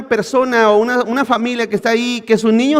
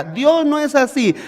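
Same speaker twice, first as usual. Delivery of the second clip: ordinary delivery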